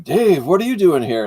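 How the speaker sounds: wide-eyed, imitated astonishment; retold surprise. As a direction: act taken aback at spotting an employee. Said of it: "his eyes get this big".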